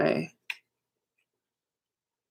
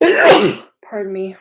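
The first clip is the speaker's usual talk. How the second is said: about to sneeze; 4 semitones higher, 6 dB louder, much slower than usual. Real, not acted. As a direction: sneeze coming on, cough breaks through. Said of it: "I'm gonna sneeze".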